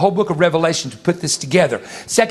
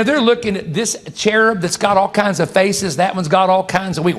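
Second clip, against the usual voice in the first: usual speaking voice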